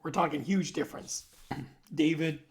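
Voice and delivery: ordinary delivery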